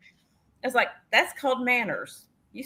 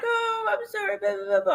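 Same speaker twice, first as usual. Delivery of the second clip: high, soft mock apology; 10 semitones higher, 4 dB quieter than usual. Sister-in-law apologizing for her kid, high mock apology. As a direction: imitate an apologizer in a small, high voice.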